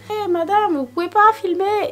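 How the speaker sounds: high, sharp mock shop clerk telling off; scolding shop clerk. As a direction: squeal like an outraged shopkeeper. Imagined shop clerk scolding her.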